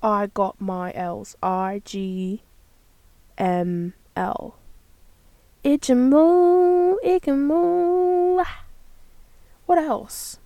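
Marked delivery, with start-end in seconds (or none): 5.6-8.4 high, loud, long drawn-out syllables; slow, high mock chant; silly sounding-out. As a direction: stretch each word out in a high silly voice. Sounding out silly made-up acronym.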